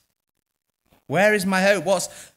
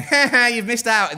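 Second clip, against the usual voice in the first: mock gloating; 8 semitones higher, 8 dB louder than usual. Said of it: "this isn't Jesus going".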